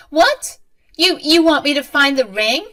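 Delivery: very high, loud, stammered question; shocked disbelief. A narrator's reading. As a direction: question the order in stunned disbelief. Mother stunned by order.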